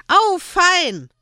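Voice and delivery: put-on high, loud little boy cheer; small boy clapping with delight; delighted child. A narrator's reading. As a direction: squeal with joy like a child. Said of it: "klatschte erfreut".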